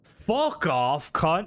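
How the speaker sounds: loud, punchy put-on Australian accent; hammed-up Aussie swearing, hit hard; mock Australian accent. Streamer answering a viewer who says he sounds Australian, mock Australian accent. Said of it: "You sound a bit Australian".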